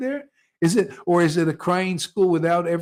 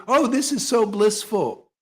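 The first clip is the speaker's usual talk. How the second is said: high, gushing fake rapture; mock blissful gushing; 8 semitones higher, 4 dB louder than usual. Mocking devotees who gush about bliss, high sugary voice. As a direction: ham up shallow bliss. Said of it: "how many Vaishnavas say".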